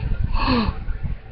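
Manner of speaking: soft, high intake of breath; amazed gasp. A narrator's reading. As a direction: gasp as the truth dawns. Girl realises who her father is.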